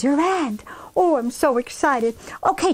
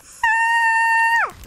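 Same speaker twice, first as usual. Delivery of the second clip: long high-pitched story scream; 3 dB louder than usual. A narrator's reading. Henrietta as her sisters pop up beside her, screaming. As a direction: let out a drawn-out high "ah". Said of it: "Henrietta screamed".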